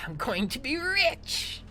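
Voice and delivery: high, gleeful villain crowing; hammed-up greedy cackling voice; gloating villain. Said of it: "And he goes".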